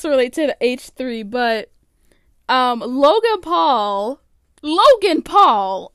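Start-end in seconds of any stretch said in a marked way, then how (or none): 2.8-5.9 slow announcer-style name read; news anchor voice. Pretend. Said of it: "I sound like a news anchor".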